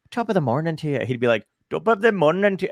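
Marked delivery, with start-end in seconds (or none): 0.1-1.0 Irish accent. Shown as a demonstration. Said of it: "instead of being like". 1.7-2.7 put-on Irish accent. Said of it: "Ken, do an Irish accent".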